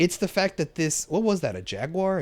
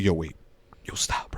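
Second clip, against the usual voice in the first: hushed warning; 11 semitones lower, 6 dB quieter than usual. Retold or imitated. Kraven halting on the trail.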